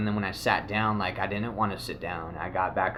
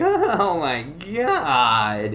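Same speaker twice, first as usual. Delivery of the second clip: much louder, higher, laughing and slowed; overwhelmed laughing; 6 semitones higher, 10 dB louder, much slower than usual. Real, not acted. Telling viewers about the drug rush hitting him, laughing loudly. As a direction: let the rush hit you, laughing.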